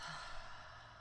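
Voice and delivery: long, airy sigh out through the mouth; demonstrated relaxing exhale; long exhaling sigh. Shown as a demonstration. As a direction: let the breath out in a slow, voiceless sigh. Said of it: "sigh it out the mouth".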